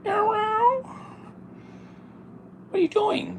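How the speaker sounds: very high soft meows; double meow. Retold or imitated. Copying the cat's double meow outside, very high. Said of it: "double meow".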